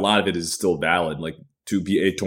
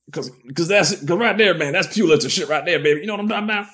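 much higher, louder bragging; hyped up; 12 semitones higher, 3 dB louder, faster than usual. Real, not acted. Praising his own book passage, high excited bragging. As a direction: pump yourself up over your own writing.

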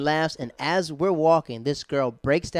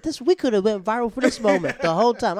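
high, loud mock lament; excited regret; 9 semitones higher, 8 dB louder than usual. Comic telling friend about missed viral moment, high excited lament. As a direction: rue the missed chance out loud.

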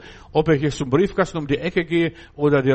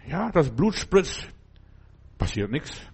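normal talking voice

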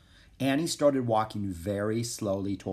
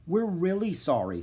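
ordinary delivery

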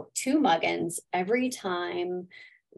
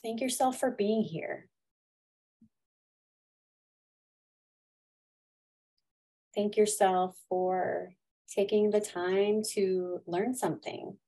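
guided meditation; 5 dB quieter than usual. Acted. Leads a gratitude meditation.

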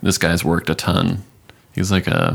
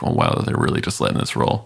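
whispered aside; 6 dB quieter, faster than usual. Retold or imitated. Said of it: "it's just like".

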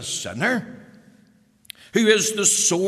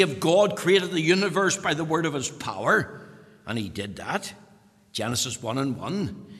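ordinary talk, nothing marked

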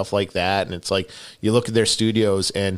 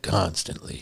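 low, quiet deadpan movie quote; dry voice; 5 semitones lower, 8 dB quieter, slower than usual. Retold or imitated. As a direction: drop it low and dry like the actor. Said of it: "That dry voice".

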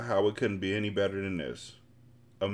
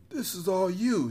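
higher, drawn-out character voice; lover's voice, admiring; 10 semitones higher than usual. Acted. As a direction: voice the boyfriend, slowly and higher. Bullet's quoted dialogue.

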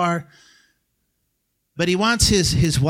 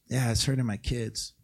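quiet admission; 6 semitones lower, 9 dB quieter than usual. Retold or imitated. Congregant's quiet admission voiced.

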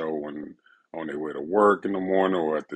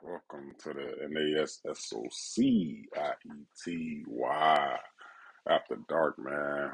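show intro; 3 dB louder, slower than usual. Acted. Podcast intro again.

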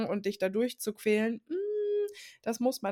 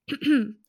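throat clearing; 4 semitones higher, 6 dB louder than usual. Real, not acted. After coughing, sucking a lozenge.

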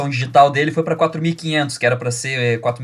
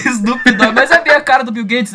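higher, laughing through the joke; 9 semitones higher, 5 dB louder than usual. Real, not acted. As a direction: giggle while saying it. Joking about Bill Gates.

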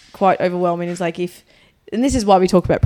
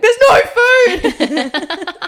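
much higher, very slow laughing whine; 12 semitones higher, 7 dB louder, much slower than usual. Retold or imitated. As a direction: wail it through laughter. Quoting her call to Geordie.

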